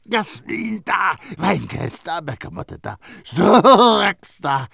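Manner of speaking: slow croaked list; croaking. A narrator's reading. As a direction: croak each name, pointing. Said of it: "krächzte Grenouille weiter".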